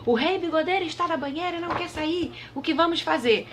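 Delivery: voz aguda de pajem aflito. A narrator's reading. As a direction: avise agudo e aflito. Pajem repete o aviso. (pajem avisando aflito)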